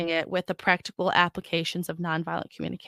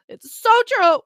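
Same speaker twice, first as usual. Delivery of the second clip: much higher, louder burst; emphatic high exclaim; excited exclamation; 14 semitones higher, 8 dB louder than usual. Real, not acted. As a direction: insist it with sudden excitement. Emphatic repetition.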